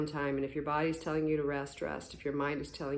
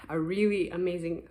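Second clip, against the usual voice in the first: ordinary talk, nothing marked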